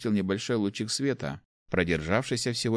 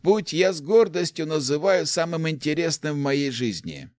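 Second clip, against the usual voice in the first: put-on higher-pitched, animated old man's voice; eager old inventor; 8 semitones higher, 4 dB louder than usual. Old inventor tells the rector of his path, animated. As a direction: proudly recount your life's work, pitched up.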